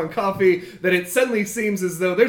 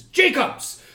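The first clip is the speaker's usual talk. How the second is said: mock yell; 9 semitones higher than usual. Boss turns to summon his goblins, a high mock yell. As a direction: call out sharply for an underling. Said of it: "he yells".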